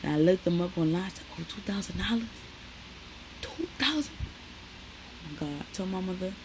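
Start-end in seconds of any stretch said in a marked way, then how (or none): 1.3-4.1 shocked at price. Retold or imitated. 4.2-5.6 whispered gasp. Retold or imitated. Shocked at shoe price.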